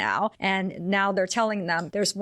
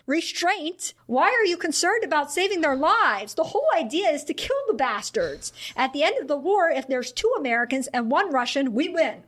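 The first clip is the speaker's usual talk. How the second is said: fervent general; 6 semitones higher than usual. Retold or imitated. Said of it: "Power responded".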